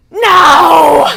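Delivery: a loud, high cry of dismay; shouted no. Retold or imitated. Retelling the coffee spill, a loud high no.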